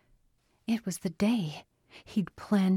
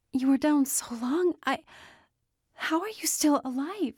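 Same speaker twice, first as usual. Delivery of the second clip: high, bewildered protest; alarmed disbelief; 9 semitones higher than usual. A narrator's reading. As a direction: stammer in baffled alarm, pitch raised. Panicked disbelief after drowning scare.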